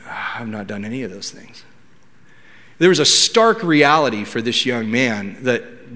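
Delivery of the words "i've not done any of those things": very quiet, low, sighing realization; deflated admission. Retold or imitated. Young man admitting to himself as he walks away, deflated. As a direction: let it sink out softly, beaten.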